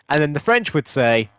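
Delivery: ordinary talk, nothing marked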